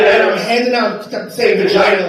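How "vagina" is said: raised, louder, confident greeting. Retold or imitated. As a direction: hand it out with full confidence. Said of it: "like, with confidence".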